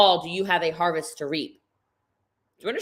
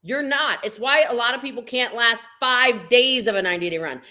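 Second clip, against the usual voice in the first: ordinary talk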